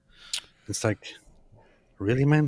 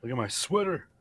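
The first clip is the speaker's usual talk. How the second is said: normal talking voice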